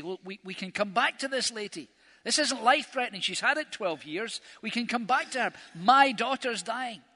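a frantic father pushing to hurry; desperate pleading. Retold or imitated. Jairus urging Jesus to hurry.

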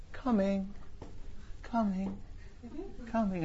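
soft, high replies fading off; distant echo. Retold or imitated. Daughters' replies echoing back from far away. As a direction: answer as if from far down the corridor. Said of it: "as it kind of echoed back down the corridor".

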